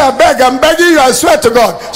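begging. Retold or imitated. Husband begging kidnappers on the phone, high desperate pleading. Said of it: "He said".

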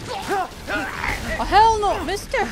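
high, very loud panicked refusal; real jump-scare shout; alarmed shout. Real, not acted. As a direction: yell it at an attacker lunging at you. Game monster attacks, player recoils.

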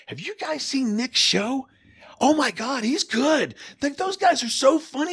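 very high, slow, impressed DJ voice; Steve raving. Retold or imitated. Radio legend praising Nick to coworkers, high and impressed. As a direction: rave about the new guy, way up high. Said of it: "I hear Steve Slayton going".

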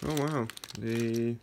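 usual speaking voice

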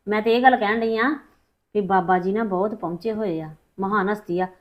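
usual speaking voice

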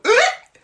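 loud, high hiccup yelp; hiccup noise. Shown as a demonstration. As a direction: throw out a sudden hiccup squawk. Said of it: "when I hiccup, I go".

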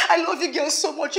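ordinary talk, nothing marked

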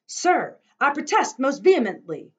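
high, loud indignant call; loud protest. Acted. Grandfather confronting the cart man, loud indignant protest. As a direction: protest with force.

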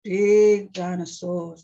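slow, loud BIG; big dinosaur emphasis. Acted. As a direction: boom out BIG for the huge dinosaurs. Voicing bigness from the book.

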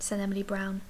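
plain reading voice

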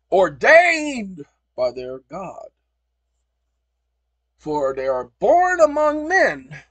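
loud, slow, high proclamation; preaching loud about God-given rights; proclaiming. Real, not acted. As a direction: orate it like a founding decree.

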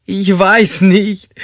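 high, loud, drawn-out protest; distressed suspect. Acted. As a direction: cry it out high and slowly. Suspect pressed by detective in story.

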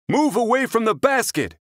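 high, firm guard-dog voice. Acted. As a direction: stand your ground like a brave pup.

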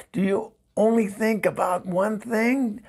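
exasperated question. Acted. Exasperated question to pestering ex-husband.

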